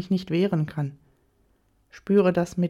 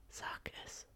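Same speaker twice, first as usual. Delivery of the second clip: soft whispered order; whispered command; 23 dB quieter than usual. A narrator's reading. Lover urging her to say it, whispered command. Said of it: "flüstert er mir ins Ohr".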